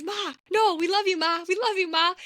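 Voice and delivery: hammed-up desperate pleading; pleading sons. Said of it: "all they keep saying is".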